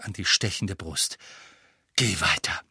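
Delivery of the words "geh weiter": low winded gasp; out of breath. A narrator's reading. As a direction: gasp it, chest aching. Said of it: "keuchte er".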